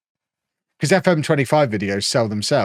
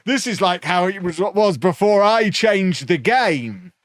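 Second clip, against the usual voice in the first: higher, slow, smug mocking voice; Zealand wang voice; 7 semitones higher, slower than usual. Quoting a rival YouTuber again, slow smug put-on voice. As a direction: stretch each word like a self-satisfied know-it-all. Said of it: "I'm just gonna do a wang voice".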